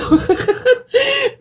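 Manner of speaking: high burst of laughter. Real, not acted. Vlogger laughs over her disgusting cold, laugh. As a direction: laugh at your own gross remark.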